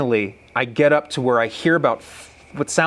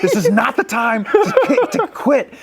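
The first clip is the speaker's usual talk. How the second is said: high, raised-voice plea; urgent protest; 13 semitones higher, 5 dB louder than usual. Retold or imitated. Hunter urging tired buddies not to quit, loud protest. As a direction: re-enact an outraged refusal to give up.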